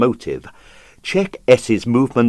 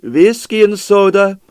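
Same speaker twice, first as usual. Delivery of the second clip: Pavel's voice; 9 semitones higher, 4 dB louder than usual. Acted. Pavel hosting, put-on voice.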